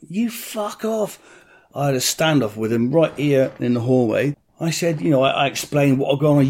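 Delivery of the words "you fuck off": slow, quiet, higher defiant curse; a hushed, stretched-out brush-off; defiant retort. Retold or imitated. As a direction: draw it out in defiance. Standoff with armed police.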